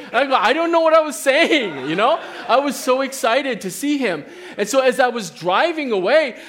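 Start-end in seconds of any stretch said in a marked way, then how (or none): 0.3-1.9 flustered laughing reply. Retold or imitated. Retelling his own flustered answer.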